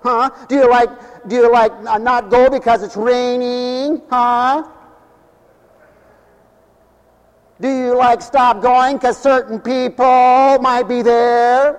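hammed-up Valley Girl voice; Valley Girl talk. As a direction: tease the congregation like a Valley Girl. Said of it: "that's the way they sound".

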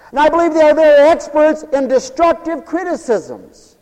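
loud, high, soaring preacher's cry; preaching at full force. Acted. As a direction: preach it out to the whole hall.

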